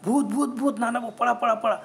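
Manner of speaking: panicked cry to run away; shouting in fright. Acted. Ganu cries out to Nanabhau to run from the ghost. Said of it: "Ganu एकदम ओरडला".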